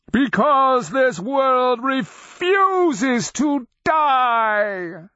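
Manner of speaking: very high, slow, full roar; roaring beast. A narrator's reading. Undead dragon answering its mocker, roaring. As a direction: roar it out, stretching to the last word. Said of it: "the undead beast roared".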